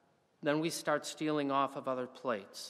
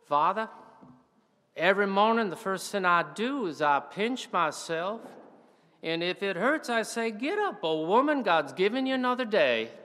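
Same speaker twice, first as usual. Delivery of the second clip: lightly voiced old Southern lady, higher; a hint of a joyful old Southern woman; elderly Southern woman; 6 semitones higher, 6 dB louder than usual.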